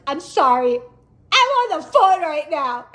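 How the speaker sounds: loud, high, upset brush-off; mock tearful snapping. Crying driver on phone to husband, tearful retort to officer. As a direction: wail it crossly, as if crying. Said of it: "I'm still in tears".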